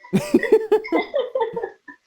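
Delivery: high rising burst of laughter. Real, not acted. Laughing hard.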